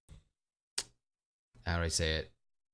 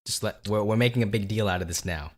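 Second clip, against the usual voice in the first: ordinary delivery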